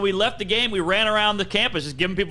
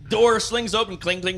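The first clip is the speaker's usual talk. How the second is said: ordinary talk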